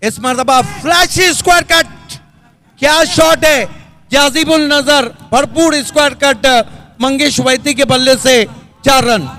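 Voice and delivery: high, loud boundary call; excited cricket commentary. Real, not acted. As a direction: shout the four with delight.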